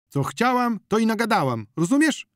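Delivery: very high, loud quarrelsome woman; loud, high-pitched defiance; defiant retort. A narrator's reading. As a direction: throw it back combatively. Zonia squares up for quarrel.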